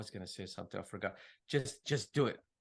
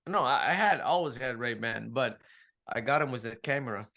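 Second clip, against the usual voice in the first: usual speaking voice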